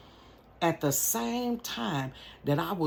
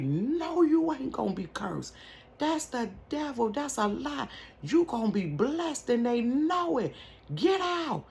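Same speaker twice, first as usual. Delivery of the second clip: insistent aunt; 10 semitones higher than usual. Retold or imitated. Aunt voiced, rising insistence.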